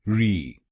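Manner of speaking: री said alone, a clear ree; syllable demo. Shown as a demonstration. Teacher drills the third syllable. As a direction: isolate री on its own. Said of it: "तीसरे शब्दांश को बोलिए री".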